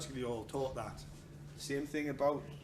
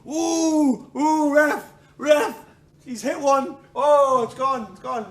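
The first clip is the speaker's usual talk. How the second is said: much higher, louder mock yelps to the ref; hammed-up pained yelp; 9 semitones higher, 13 dB louder, slower than usual. Fighter appealing to the referee, hammed-up pained yelps. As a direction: play the fighter just hit below the belt.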